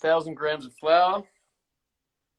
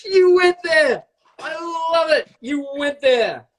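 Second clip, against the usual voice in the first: delighted shout; 12 semitones higher, slower than usual. Real, not acted. Host cheering his cohost's cheeky joke, gleeful shout.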